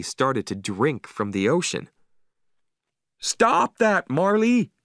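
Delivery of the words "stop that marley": high, urgent scolding call; alarmed cry. A narrator's reading. Owner calling to dog drinking seawater, alarmed cry. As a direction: cry out to stop a misbehaving dog. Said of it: "I cried".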